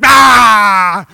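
loud open roar of rage, put on; hammed-up monster bellow; mad Hulk roar. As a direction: roar like the Hulk turning green. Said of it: "You know, really mad".

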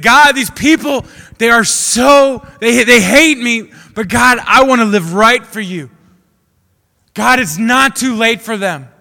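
David shouting his plea to God; shouted prayer. Retold or imitated. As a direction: cry out on your knees like David. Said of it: "just gotta yell and just scream".

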